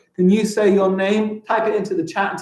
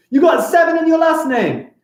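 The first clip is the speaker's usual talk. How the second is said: high, shouted disbelief; incredulous exclamation; 11 semitones higher, 3 dB louder than usual. Real, not acted. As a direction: cry out in amazement. Astonished at pupil's claim.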